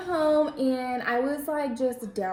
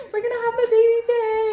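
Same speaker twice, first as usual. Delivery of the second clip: higher and louder, panicked retelling; freaking out; 11 semitones higher, 8 dB louder than usual. Retold or imitated. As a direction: blurt it out in a panic to your sister. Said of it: "I'm, like, freaking out".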